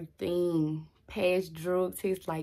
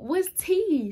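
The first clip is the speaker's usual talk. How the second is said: incredulous what's tea; 11 semitones higher, 7 dB louder than usual. Retold or imitated. Confused, then "So I'm mad.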